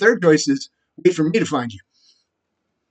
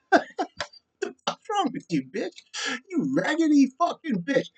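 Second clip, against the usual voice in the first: much higher, slow laughing sneer; mocking laugh; 10 semitones higher, 5 dB quieter, slower than usual. Real, not acted. Sneering at Lisa with a mocking laugh. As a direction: laugh at her while insulting.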